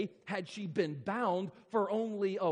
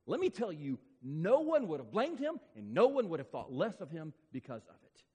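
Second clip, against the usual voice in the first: ordinary talk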